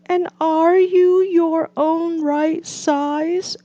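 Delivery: high, slow, anxious question; worried Toad. A narrator's reading. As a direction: ask carefully, slow and high. Toad asking.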